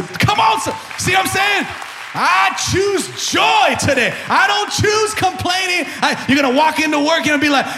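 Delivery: loud, high, shouting sermon climb; full-force preaching. Acted. Pastor rousing church to choose joy, shouting. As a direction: preach it hard and lift the room.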